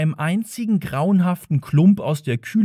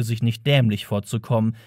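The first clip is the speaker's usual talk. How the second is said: usual speaking voice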